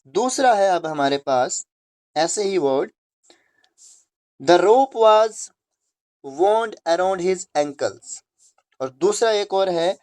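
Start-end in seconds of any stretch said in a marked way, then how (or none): 4.4-8.2 each word read out very slowly; dictating to students. Shown as a demonstration. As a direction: read the example sentence slowly. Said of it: "अलग-अलग pronounce करना है".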